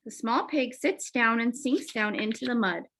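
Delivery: ordinary delivery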